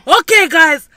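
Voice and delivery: hammed-up call to wash the dishes; mock loud announcement. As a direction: announce it loudly and slowly so dad hears. Mocking girls' trick to dad.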